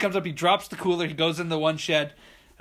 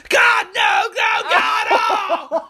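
piercingly high, loud wail; imitated screaming; 10 dB louder, much slower than usual. Woman at the water losing her dog, screamed mock anguish. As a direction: shriek in hammed-up anguish.

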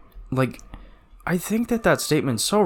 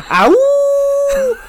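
very high loud sustained howl; cartoon howl; 7 dB louder than usual. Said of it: "slap the table a bunch of times and go".

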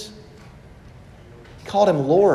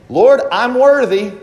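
proud Pharisee; 6 semitones higher, 6 dB louder than usual. Retold or imitated.

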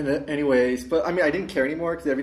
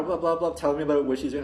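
ordinary delivery

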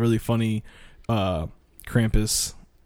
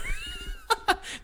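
high laugh; 7 dB quieter than usual. Real, not acted. Laughs after humming.

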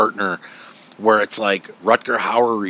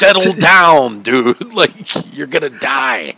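loud, raised-pitch telling-off; loud acted plea to calm down; mock exasperated shout; 4 semitones higher, 7 dB louder than usual. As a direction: imitate someone reining in a hothead. Acts out others calming the detective.

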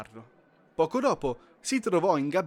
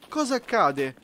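raised, higher call to a penguin; 6 semitones higher, 5 dB louder than usual. Acted. Elf calls to a dazed penguin, raised voice. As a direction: call out over the noise.

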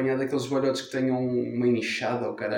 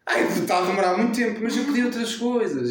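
impatient mother; 8 semitones higher than usual. Retold or imitated. Imita a mãe. (mãe impaciente, mais aguda e lenta)

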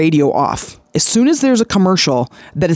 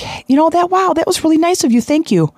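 much higher, bright and quick, delighted thanks; grateful surprise; 11 semitones higher than usual. Retold or imitated. A stranger thanking him for holding the door, delighted surprise. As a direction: gush like a stranger touched by a kindness. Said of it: "And they're like".

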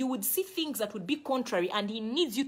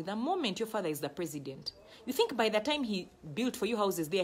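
ordinary talk, nothing marked

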